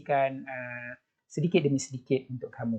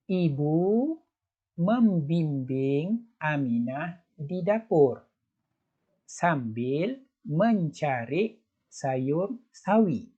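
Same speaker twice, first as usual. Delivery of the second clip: slow, careful read-aloud; slow teacher read-aloud; slow model reading; slower than usual. Shown as a demonstration. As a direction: read it slowly so children can follow. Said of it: "cuba baca sekali lagi".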